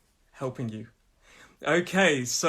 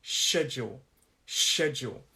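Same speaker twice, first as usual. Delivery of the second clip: SCHEDULE with weight on "SHED"; first-syllable stress; 2 semitones lower than usual. Shown as a demonstration. Teacher marks stress by hand, first syllable of SCHEDULE stressed. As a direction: punch "SHED" in each SCHEDULE, falling after. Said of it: "to show you where the stress is".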